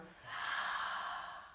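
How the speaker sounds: long, airy voiceless breath out; demonstrated exhale. Shown as a demonstration. Yoga teacher breathing out with the class, audible exhale. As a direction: breathe out audibly and slowly through the mouth.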